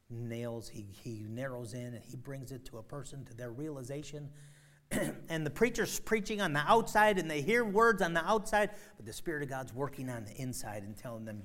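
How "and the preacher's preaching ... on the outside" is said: much louder and higher, pounding; full-force preaching. Real, not acted. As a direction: preach it out hard.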